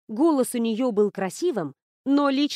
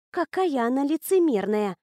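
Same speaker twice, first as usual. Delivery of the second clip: ordinary delivery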